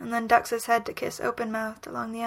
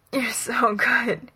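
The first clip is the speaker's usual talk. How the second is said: "you're so good" slow, breaking into a choked whisper; groaned and choked; 5 dB louder, slower than usual. A narrator's reading. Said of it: "he groans and chokes".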